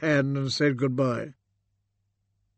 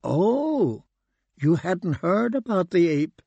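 raised, surprised voice; 4 semitones higher than usual. Acted. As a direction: caught off guard and a little amazed. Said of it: "Kate looked surprised".

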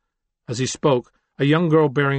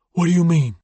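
higher, louder, anxious report; nervous man voice; 6 semitones higher, 5 dB louder than usual. Acted. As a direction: rattle off the bad news with rising urgency. Fade Out questions Brennan.

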